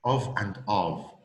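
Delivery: first "Of" with a full o sound, the second weak like "av"; strong and weak of. Shown as a demonstration. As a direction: contrast "Of" strong then weak. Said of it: "When we say of and when we say of".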